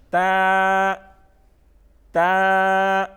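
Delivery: تا loud, vowel held long and even; stretched letter. Shown as a demonstration. Teacher repeats letter for pupils, تا stretched long. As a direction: draw تا out on one note.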